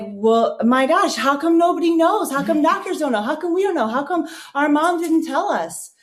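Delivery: retold exasperation, pitched well up; exasperated outrage. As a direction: fire off the questions in rising frustration. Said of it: "I started getting pissed off".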